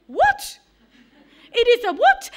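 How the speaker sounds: a laughing incredulous what; astonished. Retold or imitated. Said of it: "She was like".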